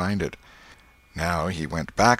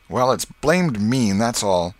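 sulky; 4 semitones higher, 5 dB louder, slower than usual. A narrator's reading. Joe sulks.

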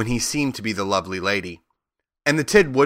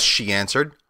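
ordinary delivery

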